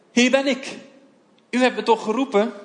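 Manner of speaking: put-on a child answering his master's call, keen; young boy Samuel. Jonge Samuel rent naar Eli. (gretige jongensstem, hoger)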